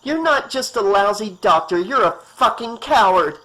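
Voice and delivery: furious woman insulting a cowardly doctor; seething anger. Acted. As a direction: spit the insult at him. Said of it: "seething anger".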